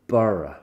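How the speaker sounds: borough said as English "burra". Shown as a demonstration. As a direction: model borough for learners. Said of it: "on écoute [name]".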